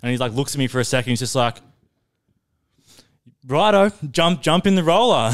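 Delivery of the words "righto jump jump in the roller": boss relenting. Retold or imitated. Boss giving in.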